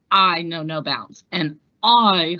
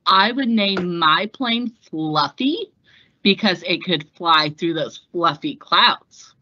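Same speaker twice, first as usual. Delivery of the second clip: ordinary talk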